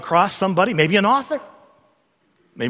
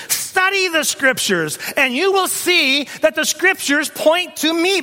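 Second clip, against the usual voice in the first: impassioned challenge building to a high, ringing peak; preacher voicing a rising challenge; Jesus challenging them; 10 semitones higher than usual. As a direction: start measured, then climb higher and more forceful. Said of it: "Jesus had challenged them with that".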